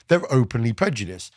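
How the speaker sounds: ordinary delivery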